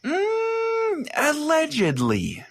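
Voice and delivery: high, slow, drawn-out knowing hum; coy high "allegedly" that hints at a secret. Real, not acted. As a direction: let the doubt hang on every syllable. Hinting at a conspiracy theory.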